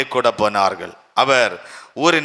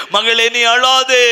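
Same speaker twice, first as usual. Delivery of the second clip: urgent plea; 9 semitones higher, 5 dB louder, slower than usual. Acted. Jesus voiced, urging.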